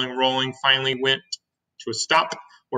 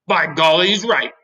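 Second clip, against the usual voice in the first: louder, higher centipede exclamation; startled agreement; 5 semitones higher, 6 dB louder than usual. Acted. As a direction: exclaim as if it just struck you.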